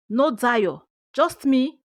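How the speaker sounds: plain reading voice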